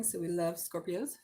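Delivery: ordinary talk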